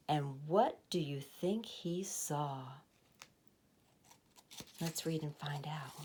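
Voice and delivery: conspiratorial whisper. A narrator's reading. Reader to children, a hushed invitation to keep reading. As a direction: lean in and hush the children. Teasing listeners before reveal.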